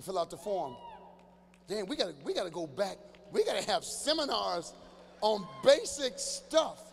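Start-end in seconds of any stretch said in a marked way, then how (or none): 3.3-6.8 slow, drawn-out, rising to a shout; slowing down and getting louder; slow exasperated emphasis. Real, not acted. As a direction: spell it out slowly like a fed-up preacher. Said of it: "exasperated preaching to audience".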